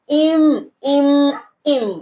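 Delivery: "इम" slowly repeated on its own; first syllable isolated. Shown as a demonstration. Teacher has pupils notice the sound, slow repeated "इम". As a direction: stretch each "इम", pausing between. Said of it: "आपने ये देखना".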